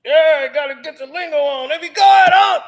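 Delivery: loud excited build to a shout; hype. Pretend. Hyping up the club, excited shout. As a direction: get them going, rising.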